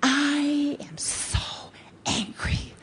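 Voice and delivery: hushed, serene mock calm; soft serene. Shown as a demonstration. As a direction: murmur it calmly into their face. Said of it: "you just say".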